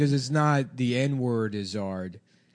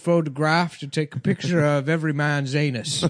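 pompous Victorian gentleman; 3 semitones higher, slower than usual. Acted. Inventor presenting in 1800s.

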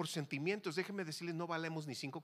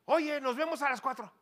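higher, louder casual invitation; calling out; 8 semitones higher, 7 dB louder than usual. Retold or imitated. Man proposing a meeting to his Sonoran friend, calling out. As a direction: call brightly to a buddy.

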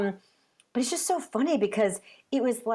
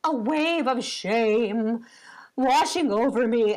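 put-on drawn-out melodramatic child's lament; hammed-up tearful confession, slowly; very dramatic; much slower than usual. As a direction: imitate an overwrought kid, stretching the words. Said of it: "he's very dramatic".

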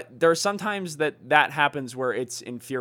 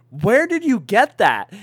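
surprised; 6 semitones higher, 5 dB louder than usual. Retold or imitated. Family staring at Pete.

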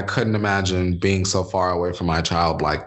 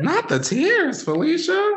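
high, teasing mock gasp; high mock dismay. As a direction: squeal it like a dramatic friend. Teasing co-host about crying.

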